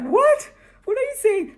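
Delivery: a mock gasp of astonishment from the class; amazed outcry. Kids react to the story.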